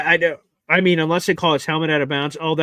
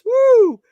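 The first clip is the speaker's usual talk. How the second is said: loud whoop; 10 dB louder than usual. Real, not acted. Continued celebration.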